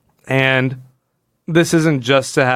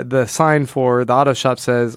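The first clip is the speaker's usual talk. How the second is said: ordinary talk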